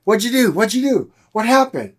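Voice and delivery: put-on the medical team stunned that the baby's heart restarted; astonished staff. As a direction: fire off the questions in disbelief. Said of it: "and everybody's like".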